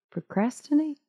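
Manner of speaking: Procrastinate? with a high rising question tune; puzzled question. Shown as a demonstration. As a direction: ask Procrastinate? as if the word sounds strange. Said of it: "that word just got weird".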